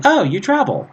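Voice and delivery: high-pitched, lilting mock remark that falls away; telling, not commanding. Shown as a demonstration. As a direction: say it as an observation, pitched well up. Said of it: "I'm telling my friend that he travels".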